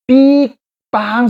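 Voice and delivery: drawn-out toot then blast; imitated honk. Shown as a demonstration. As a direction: mimic a horn's peep and loud parp. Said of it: "소리 나는 겁니다".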